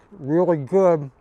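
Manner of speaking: talking through a cookie; words round a mouthful; mouth full. Real, not acted. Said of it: "Shouldn't talk with my mouth full".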